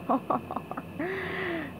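soft, private scheming chuckle; chuckle to himself. Acted. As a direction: chuckle quietly over your own plan. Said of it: "He chuckled to himself".

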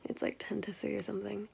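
soft unvoiced whisper. Real, not acted. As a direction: whisper it, it's late. Whispering late at night.

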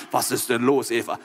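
low, patient God voice; deep-voiced patient inquiry; calm God. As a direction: ask in a deep, settled voice. God calmly asks Eve aside.

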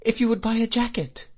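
very high, timid request; trembling. A narrator's reading. Frightened boy offering his jacket for sale, trembling. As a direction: ask nervously in a small high voice. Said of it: "I said, trembling".

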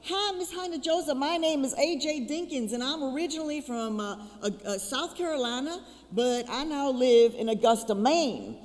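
Southern accent voiced high; imitating his accent. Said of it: "there's this guy who's got this accent".

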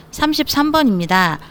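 ordinary talk, nothing marked

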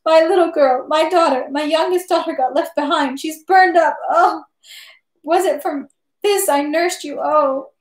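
high, tearful lament; through sobs. A narrator's reading. Mother telling of daughter left in the fire, sobbing lament. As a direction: grieve aloud, acted sobs in the voice. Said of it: "she managed to say through her sobs".

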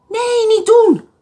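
put-on high, loud no from an old woman; storybook old lady shouting no; old lady crying out. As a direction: cry out to stop him. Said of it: "riep de oude dame".

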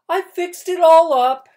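loud, high triumphant announcement; announcing. Acted. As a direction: proclaim it grandly. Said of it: "she announced".

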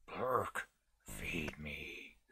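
a monster calling up from the cellar. Pretend. Reciting the Trap Door theme.

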